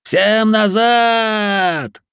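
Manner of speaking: very drawn-out, high bellow; commanding yell. A narrator's reading. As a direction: bellow slowly to turn a crowd. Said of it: "заорал он внезапно прорезавшимся командным голосом".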